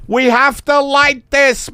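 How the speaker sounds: high, insistent boss voice; insistent photographer. Acted. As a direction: press the point on a balky model.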